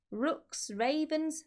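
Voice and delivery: plain reading voice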